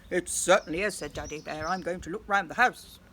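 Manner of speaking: gruff-ish big bear, lightly done; a hint of Daddy Bear.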